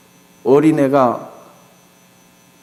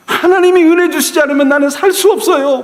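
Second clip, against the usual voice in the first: high, loud crying-out prayer; tearful plea; 10 semitones higher, 4 dB louder than usual. Acted. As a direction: beg through tears as if your life depends on it. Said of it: "울며 간구한 거예요".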